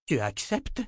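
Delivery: choked disbelief. Acted. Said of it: "s'étrangla Verna".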